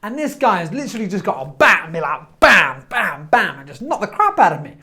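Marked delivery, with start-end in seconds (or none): 2.4-3.6 punchy imitated bat blows, louder; describing the rude man as beating him with a bat; bat hits. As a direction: hit each bam like a strike.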